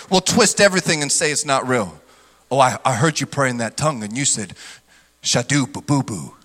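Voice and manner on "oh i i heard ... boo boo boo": low, soft sneering critic mocking tongues. Critic mocking a believer's tongue, low sneer. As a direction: sneer at the tongue-speaker, then laugh it off.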